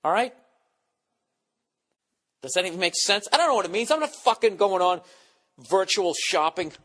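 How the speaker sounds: ordinary delivery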